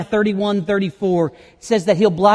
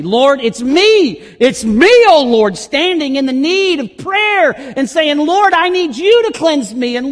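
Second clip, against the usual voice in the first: high, loud, pleading cry to the Lord; impassioned preaching; 9 semitones higher, 7 dB louder than usual. Acted. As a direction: preach it out as a fervent prayer. Pleading prayer, rising preaching.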